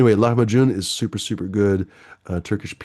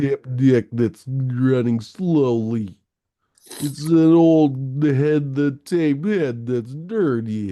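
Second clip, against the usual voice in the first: dragged out like a dirty tape head; slowed-down warped tape sound; 4 semitones higher, 3 dB louder, much slower than usual. Pretend. Streamer mimics a slow tape, dragging each word. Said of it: "It sounds more like that".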